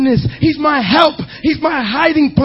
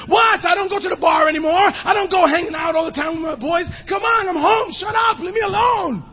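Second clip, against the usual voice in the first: higher, irritated snapping dad; defensive husband; 5 semitones higher, 4 dB quieter, faster than usual. Acted. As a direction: push back like a grumpy husband caught out. Husband defending himself at home.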